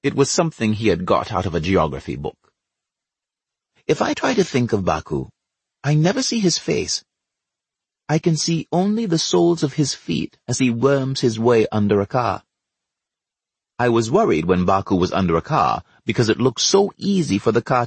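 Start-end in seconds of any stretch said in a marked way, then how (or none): none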